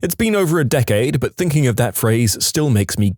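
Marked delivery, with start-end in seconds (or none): none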